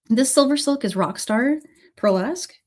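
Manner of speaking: normal talking voice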